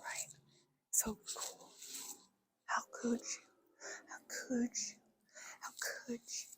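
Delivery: hushed, whispery self-talk; very soft private muttering, half whispered; mumbling under breath. Shown as a demonstration. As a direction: murmur to yourself, barely audible. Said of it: "talking quieter".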